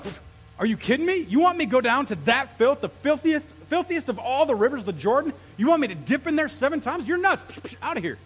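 incredulous rant, high and loud; mad, offended Naaman. Acted. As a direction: act out an offended man scoffing at the order. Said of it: "He was mad".

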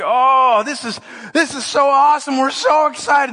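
high, gushing mock delight; gleeful excitement. Sven and Oli delighting in front of the devil, gleefully excited. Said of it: "they're just laughing and smiling".

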